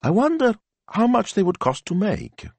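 high, musing wondering aloud; thoughtful. A narrator's reading. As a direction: ponder a money-making idea. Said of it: "she looked thoughtful".

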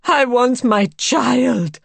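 loud, high, drawn-out demand; impassioned cry. A narrator's reading. Mother demanding her child, crying out with savage passion. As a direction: cry it out slowly and fiercely. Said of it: "she cried".

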